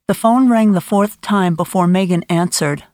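usual speaking voice

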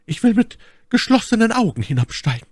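high-pitched voice for the lady; Milady's voice. A narrator's reading. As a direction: play the lady far up in pitch. Narrator voices Milady in dialogue. (hohe Stimme der Mylady)

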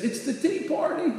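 high-pitched imitation of a crying girl; mock tearful little voice; tearful child. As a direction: act out a daughter answering through tears. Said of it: "she's still crying".

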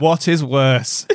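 slow, weighty rhetorical question; slow dramatic. Real, not acted. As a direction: pose it slowly for effect. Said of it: "I ask you".

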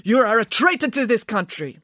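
high, indignant accusation; pompous, accusing director voice; denouncing. Acted. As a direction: denounce him as an outraged official. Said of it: "was still denouncing the minister".

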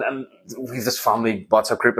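ordinary delivery

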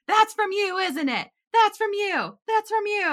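high, loud accusing repeat; accusing dad. Retold or imitated. Dad to daughter over beer cans, loud accusing. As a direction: hammer the accusation home. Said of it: "He's like".

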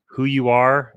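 normal talking voice

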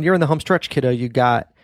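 ordinary delivery

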